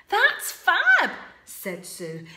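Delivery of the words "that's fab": high, loud, impatient princess voice; a fed-up princess moaning from her tower; cheeky princess, higher and louder; bored princess. Acted. Sue speaking.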